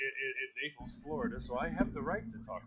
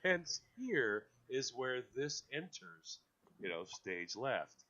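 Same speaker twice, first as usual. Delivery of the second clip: slow and deliberate; 3 dB louder, slower than usual. Real, not acted. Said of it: "explains emphatically".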